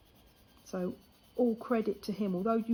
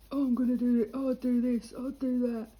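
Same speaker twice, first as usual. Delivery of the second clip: loud cocky lad, mocked; mock boasting lad; 4 semitones higher, 6 dB louder, faster than usual. Mocking the mouthy lad next door, loud and cocky. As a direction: brag it loudly like a show-off kid.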